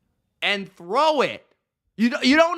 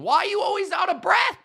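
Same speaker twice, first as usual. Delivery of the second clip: higher, fast shouted question; exasperated shout; 7 semitones higher, faster than usual. Real, not acted. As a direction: yell it at him, fed up. Ranting at the quarterback.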